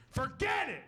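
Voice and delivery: high, exasperated mock outburst; exasperated wife. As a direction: imitate a wife giving up in a huff. Wife storming off in quarrel.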